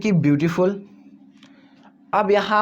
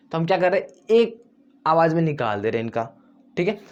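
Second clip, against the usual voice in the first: usual speaking voice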